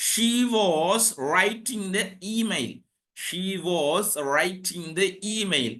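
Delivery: very slow, measured example sentence; deliberate teacher dictation. Shown as a demonstration. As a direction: space out each word for note-takers.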